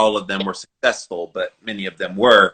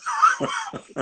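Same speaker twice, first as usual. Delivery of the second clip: high squealing laugh; real laugh; 4 dB quieter than usual. Real, not acted. Host laughing at his own "Sup", high squeal. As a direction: burst into a giggle.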